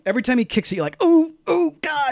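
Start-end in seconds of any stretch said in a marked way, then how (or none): 1.0-2.1 high-pitched sympathetic "ooh"; pained wincing. Acted. Viewer watching Daniel kick wooden legs, acted pained wincing. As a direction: wince aloud as if feeling each kick.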